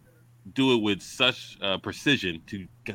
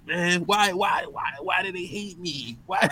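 mock whiny villain; 8 semitones higher than usual. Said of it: "He's like".

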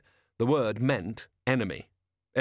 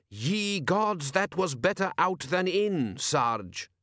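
higher, slow, puzzled imitation; imitating Ankh-Morpork soldier; 7 semitones higher, much slower than usual. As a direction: sound out foreign words. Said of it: "It sounded like".

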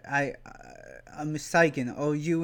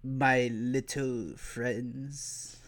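a hint of a creepy Sith lord greeting his guests; Darth Sidious impression; 4 dB quieter than usual. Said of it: "a Darth Sidious, impression for some reason".

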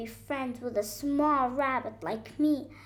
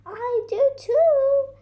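high, slow, loud little-deer voice; drawn-out high baby-animal voice; 13 semitones higher, 9 dB louder than usual. A narrator's reading. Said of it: "the baby deer said".

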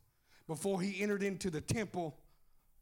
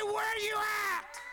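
frustrated shout; 9 semitones higher, slower than usual. Acted. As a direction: yell it up at heaven.

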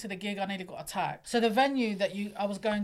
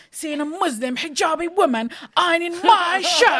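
higher and louder, half-laughing horror; mock horrified exclaim; 8 semitones higher, 11 dB louder than usual. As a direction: squeal the ending like it's a scandal. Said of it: "He's like".

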